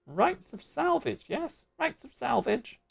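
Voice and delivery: swooping, squawky bird-creature voice; squawking bird creature. Acted. Bird creature guarding balloon wreckage, squawking. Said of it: "bird noises".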